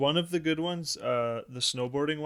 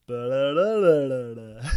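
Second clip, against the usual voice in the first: robot sound effect; 7 dB louder than usual. Retold or imitated. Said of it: "the robot goes".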